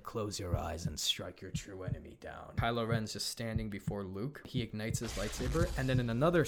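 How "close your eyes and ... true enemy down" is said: lightly voiced, low and soft; a hint of a menacing emperor; Snoke voice. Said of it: "Snoke does that whole bit".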